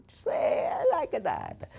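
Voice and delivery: high, hammed-up Italian accent; mock Italian accent. Auctioneer admiring an Italian jersey, comic Italian accent.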